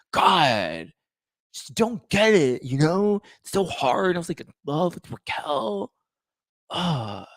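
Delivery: high, breathy, whiny Sandoval voice; Sandoval impression. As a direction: do your breathy Sandoval, sulky and self-pitying. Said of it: "as breathy as my version of Sandoval".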